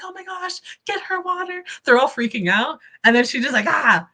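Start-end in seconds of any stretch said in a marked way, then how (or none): 0.0-1.6 much higher, softer mock panic; mock panicked girls. Mimicking the girls crowding the fainted host, high mock panic. 3.7-4.1 a short raised mock gasp of fainting. Acting out the host fainting on the floor, mock gasp.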